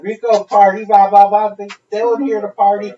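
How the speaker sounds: high, loud, mocking party chant; drunk woman. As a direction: parody her chant. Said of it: "and she said".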